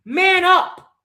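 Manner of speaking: high, loud command; furious shout. Real, not acted. Fan yelling at the crying player, high and loud. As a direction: yell it like a drill order.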